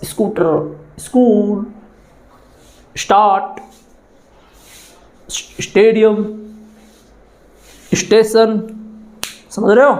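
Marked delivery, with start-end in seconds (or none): 0.0-1.6 "स्कूटर" and "स्कूल" opening on an is sound; added is sound. Shown as a demonstration. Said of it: "तो इसकी ध्वनि निकलती है". 3.0-8.6 "स्टार्ट", "स्टेडियम", "स्टेशन" opening on an is sound; word-by-word demo of "स्टार्ट", "स्टेडियम", "स्टेशन", i before S; added is sound. Shown as a demonstration. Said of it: "तो इसकी ध्वनि निकलती है".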